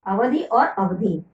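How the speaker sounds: अवधि और अवधि said slowly, the first अवधि with short a-, the second with long आ-; careful contrast. Shown as a demonstration. Teacher to children, carefully contrasting two अवधि words. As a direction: say both slowly to mark the vowel difference. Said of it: "उच्चारण तो बहुत ही संभाल के करना चाहिए".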